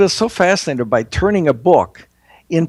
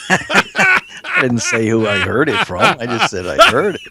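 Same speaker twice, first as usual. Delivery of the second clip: laughing. Real, not acted. Teasing while laughing.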